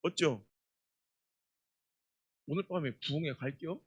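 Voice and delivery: soft, low Chungcheong reply; Chungcheong accent. Shown as a demonstration. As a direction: say it the roundabout Chungcheong way. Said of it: "예를 들어 이런 거예요".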